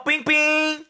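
put-on high, sugary baby voice; cutesy aegyo. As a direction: coo like a kid-show host. (애교 섞인 목소리)

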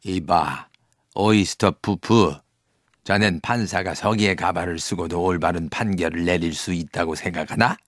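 low-pitched judge's voice; condescending, deep-voiced superior; reproving judge. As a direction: drop low and admonish the clerk. Acted judge rebuking his clerk.

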